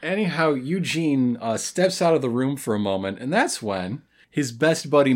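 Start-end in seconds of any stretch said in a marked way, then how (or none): none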